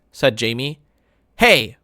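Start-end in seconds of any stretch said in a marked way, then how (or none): 1.4-1.8 louder, sharp "Hey"; mother yelling at her son, louder. Acted. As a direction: cut him off with a yell. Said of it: "Mom yelled".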